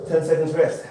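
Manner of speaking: usual speaking voice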